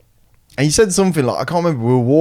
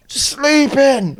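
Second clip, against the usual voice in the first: put-on sleepy drunk. Drunk friend's answer.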